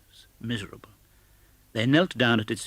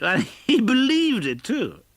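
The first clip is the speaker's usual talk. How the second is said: high, louder, slow through laughter; laughing cry; 13 semitones higher, 5 dB louder, slower than usual. A narrator's reading. Nephew to his guests about Scrooge, amused cry. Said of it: "cried Scrooge's nephew".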